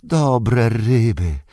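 high, slow creature voice with drawn-out vowels; hissy, whining muttering to oneself, stretched and high; Golum. A narrator's reading. Golum alone with his fish, lamenting the lost treasure, high hissing murmur. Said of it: "rozległ się świszczący szept".

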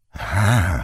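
ordinary talk

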